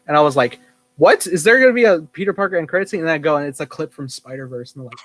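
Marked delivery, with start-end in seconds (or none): 1.0-3.0 higher-pitched startled question; replaying own astonishment at a teaser; retold surprise. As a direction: act out a startled reaction. Retells own startled reaction to teaser.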